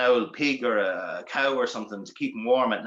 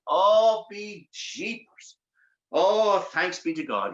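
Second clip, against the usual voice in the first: slow, drawn-out gasp of relief; acted waking relief; relieved; 6 semitones higher, much slower than usual. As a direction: let it out slowly, thankful it's over. Tom wakes from the nightmare.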